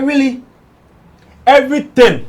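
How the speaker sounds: shocked, loud and high pitched; surprised. Retold or imitated. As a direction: exclaim in disbelief. Reacting in disbelief to artist.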